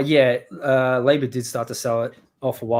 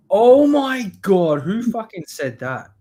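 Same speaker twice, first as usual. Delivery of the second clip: shocked disbelief; 7 semitones higher, 6 dB louder, slower than usual. Real, not acted. Shocked by a comment.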